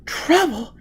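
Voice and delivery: put-on high, fearful girl's cry; shaking cry. A narrator's reading. Gisbella crying out in fear before the tunnel. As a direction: pitch way up, let it tremble a little. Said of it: "cried in a shaking voice".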